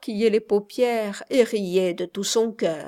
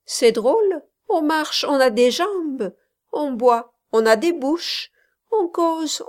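put-on higher, louder girlish prattle; tipsy chatter; 4 semitones higher, 5 dB louder than usual. A narrator's reading. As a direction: babble away, flushed with absinthe. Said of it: "un terrible bavardage à la langue".

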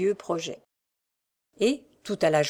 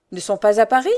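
louder, understated urgency; eager question; 7 dB louder than usual. A narrator's reading. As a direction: jump in keenly with the question. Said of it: "demanda le rémouleur avec empressement".

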